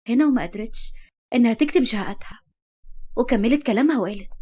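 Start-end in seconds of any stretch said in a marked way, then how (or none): none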